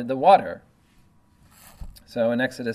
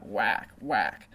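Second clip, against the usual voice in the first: two quick imitated whack noises; mock whacking sound; 4 dB quieter than usual. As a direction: sound out the rod striking stone. Imitating Moses striking rock.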